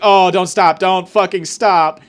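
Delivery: loud, drawn-out ecstatic cry; hammed-up heated begging; mock moaning plea. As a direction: moan it out loud, stretching the last words. Mock sexual begging retold.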